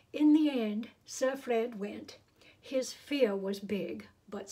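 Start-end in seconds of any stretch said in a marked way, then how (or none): none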